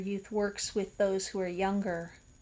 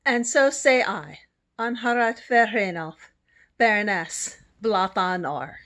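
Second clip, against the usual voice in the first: formal declaration; 7 dB louder than usual. Acted.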